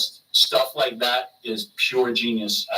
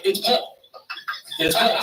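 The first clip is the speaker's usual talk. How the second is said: sneaky Paul imitation; 8 semitones higher than usual. Paul pretending on phone.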